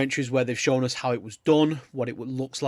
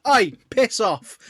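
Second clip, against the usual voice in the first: a slow bellowed Oi, piss off, higher and louder; mock shout; 8 semitones higher, 6 dB louder, slower than usual. Grumpy old neighbour shooing kids off, mock shout.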